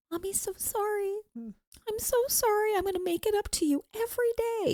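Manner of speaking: high, soft, begging forgiveness; pleading apology. Retold or imitated. Mother pleading with her hurt son, high and soft. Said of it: "And I'm like".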